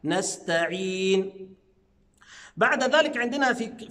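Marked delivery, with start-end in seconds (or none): 0.0-1.5 "نستعين" very slow, thin s and t; light letters. Shown as a demonstration. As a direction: recite "نستعين", stretching the final ee. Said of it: "كلها حروف مرققة".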